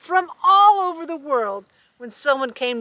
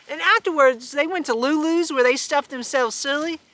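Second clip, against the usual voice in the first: usual speaking voice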